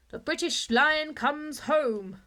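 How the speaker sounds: big, slow, booming newspaper headline; roaring headline. A narrator's reading. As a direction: proclaim it slowly like a banner headline. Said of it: "roared the newspapers".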